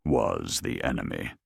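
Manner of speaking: ordinary delivery, nothing marked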